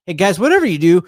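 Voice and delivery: agitated fan yelling advice; urgent warning. Real, not acted. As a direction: call out urgently mid-play. Urgent warning to players during fumble.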